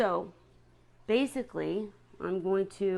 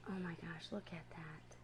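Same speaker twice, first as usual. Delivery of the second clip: breathless whispered wonder; awed whisper; 2 semitones lower, 14 dB quieter than usual. Real, not acted. Keeper whispering in awe at her seahorse's patterns. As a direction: whisper it like you might scare them.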